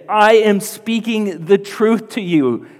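high, loud, slow proclamation; emphatic declaration. Retold or imitated. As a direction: preach it as Jesus laying it all out. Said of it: "putting all of his cards out there".

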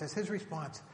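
ordinary talk

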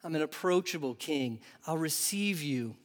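kind reassurance. Retold or imitated. Preacher voicing Jesus as approachable king, soft and kind. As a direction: reassure them gently you'll receive them. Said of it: "he says".

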